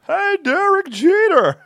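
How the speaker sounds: hammed-up Seinfeld greeting; high, loud, slow mock Seinfeld; Seinfeld impression. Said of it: "another terrible accent that we do".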